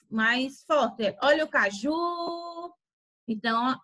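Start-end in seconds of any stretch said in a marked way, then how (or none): 1.2-2.7 high, drawn-out hawker's call; market vendor's cry. Acted. Market seller calls out cashew fruit in a drawn-out vendor cry. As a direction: hawk it, holding the last syllable of caju. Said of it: "Quando o feirante fala".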